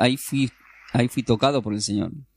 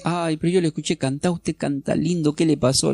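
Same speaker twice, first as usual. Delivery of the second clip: a lightly voiced old lady, higher; old lady's voice; 6 semitones higher than usual. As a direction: gently mimic the kind elderly woman, pitched up. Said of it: "es una señora de edad".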